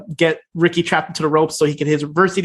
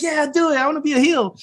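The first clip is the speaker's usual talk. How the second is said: high-pitched cheer, lightly voiced fan; high mock cheering; 9 semitones higher than usual. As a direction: cheer him on, high and eager. Crystal cheering heel turn.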